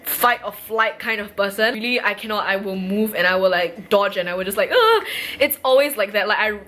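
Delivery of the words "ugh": high, loud yelp of recoil; startled ugh. Retold or imitated. Showing her fight or flight reflex, startled loud ugh. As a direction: imitate a jumpy dodging reflex.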